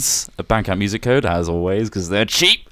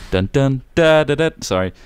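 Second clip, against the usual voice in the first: ordinary talk, nothing marked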